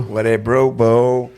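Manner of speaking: "What did Brew Boo" at half speed; drawn-out repeat. Retold or imitated. Host repeats hoarse co-host's catchphrase, very drawn out.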